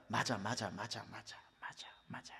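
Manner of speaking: murmured yes yes, fading from soft to a breathless whisper; defeated agreement dying away into a whisper. Acted.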